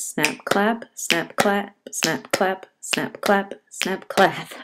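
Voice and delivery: soft, even snap clap beats, clap stressed; snap clap rhythm. Shown as a demonstration. Teacher leading students in an iambic snap clap, soft even chant. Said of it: "So it goes like this".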